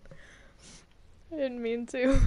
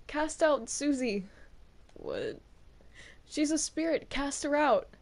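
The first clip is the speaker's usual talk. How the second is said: ordinary talk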